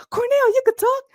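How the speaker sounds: very high, loud cartoon outburst; high-pitched shocked sidekick impression; astonished cartoon character. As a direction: squeal it in mock disbelief, like the theme song. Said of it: "and then Bernie Cohen".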